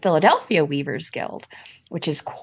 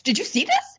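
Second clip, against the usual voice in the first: "Did you see this?" pitched higher, rising at the end; eager, pitched-up question; excited question; 8 semitones higher than usual. Retold or imitated. Nudging guildmates at the table, pitched-up excited question. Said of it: "Calm down".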